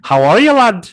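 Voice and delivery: high, loud mock Irish greeting; Irish accent impression. Mimicking an Irish examiner greeting the candidate, loud mock Irish accent. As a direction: boom it out like a jolly Irish examiner. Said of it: "you hear something like".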